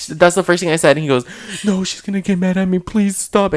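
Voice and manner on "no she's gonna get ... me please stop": higher-pitched mock pleading; acted-out panicky plea; imitated tearful pleading. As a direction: beg like a friend about to cry. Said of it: "And he started crying".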